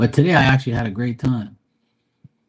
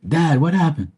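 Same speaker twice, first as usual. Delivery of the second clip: calling out to dad; 7 semitones higher, 5 dB louder than usual. Retold or imitated. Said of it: "Then I said".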